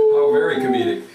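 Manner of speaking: amused. Real, not acted. Reacts to joke, laughing.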